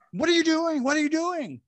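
high, loud panicked scream; panicked yelling. Retold or imitated. Friends being shot at by guards, screaming in panic. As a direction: yell it in terror under gunfire.

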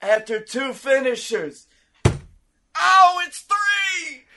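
building to high excited call; wrestling commentator. Acted. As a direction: count the pin then explode. Calls the pinfall.

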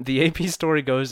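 ordinary talk, nothing marked

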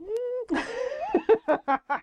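tiny high comic squeak; beetle squeal. Acted. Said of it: "and the little beetle went".

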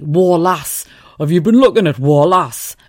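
loud mock Geordie calling out; Geordie accent. Geordie lad asking about his girlfriend, loud Geordie accent. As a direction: bark it like a Newcastle lad. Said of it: "a new one for me".

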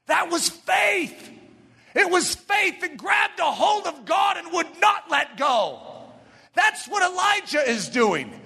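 high, slow, ringing proclamation; full-force preaching. Real, not acted. Preacher proclaiming the woman's faith, full-force preaching. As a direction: stretch each key word out high.

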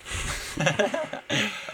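high-pitched falling laugh. Real, not acted. Laughing at peanut oil joke.

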